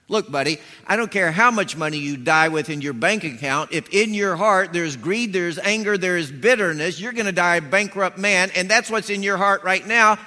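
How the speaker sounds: loud, rising sermon rebuke, hammering each point; full-force preaching. Acted. Pastor rebuking a greedy man before the congregation, loud and fierce. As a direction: preach it hard at the crowd.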